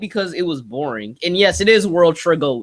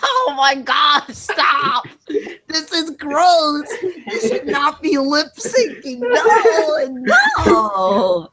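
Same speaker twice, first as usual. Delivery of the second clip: screaming in disgust; 8 semitones higher, much slower than usual. Real, not acted. Disgusted at lip syncing avatar.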